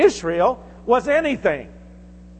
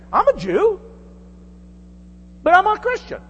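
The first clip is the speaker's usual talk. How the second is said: voicing Paul; 11 semitones higher than usual. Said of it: "the Apostle Paul says".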